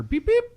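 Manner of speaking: high mock beeping; scanner beep. Imitating the Walmart scanner beeping the pickles, high beep. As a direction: chirp like a checkout scanner.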